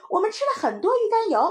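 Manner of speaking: put-on very high, loud, fast kid; proud little child. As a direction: blurt it out proudly to mum. Child proudly tells mother. (小孩得意地高声快说)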